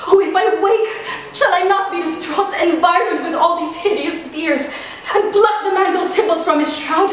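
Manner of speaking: fear building to frenzy; mounting hysterical dread onstage; Juliet. Acted.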